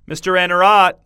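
"Mister Anarot!" hailed loudly, with the stress thrown onto the end of Anarot; called out, final-syllable stress. A narrator's reading. Man calls out to Mister Anarot by name, stressing the last syllable of "Anarot". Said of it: "putting the stress on the final syllable".

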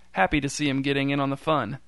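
usual speaking voice

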